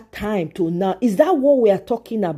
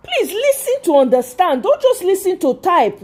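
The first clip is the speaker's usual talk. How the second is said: high-pitched, insistent scolding; exasperated plea; 8 semitones higher than usual. Real, not acted. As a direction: beg them to actually listen. Scolds commenter for not listening.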